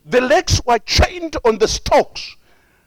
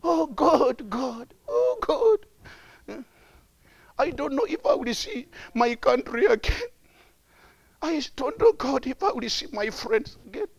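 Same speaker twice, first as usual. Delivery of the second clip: soft, tearful murmur; put-on whimpering, quieter than preaching; mock complaining; 8 dB quieter than usual. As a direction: imitate a despairing man murmuring to God. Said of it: "complaining and murmuring".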